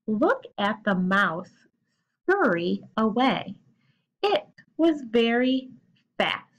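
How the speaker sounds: put-on slow, clear reading for children; teacher reading the example sentence slowly; slow read-aloud. Shown as a demonstration. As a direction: read each word deliberately to the class. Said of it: "listen to [name]".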